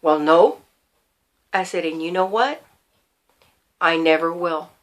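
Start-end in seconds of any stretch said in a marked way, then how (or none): 0.0-0.6 low, sober, halting answer; real serious. Retold or imitated. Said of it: "he got real serious". 1.8-4.7 soft, low, calm resolve; real quiet. Retold or imitated. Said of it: "I got real quiet".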